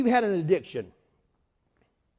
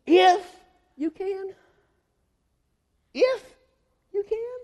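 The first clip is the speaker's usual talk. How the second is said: disbelieving, pitched-up repeat; incredulous echo; 11 semitones higher, 4 dB louder, slower than usual. Acted. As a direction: throw the words back in astonishment.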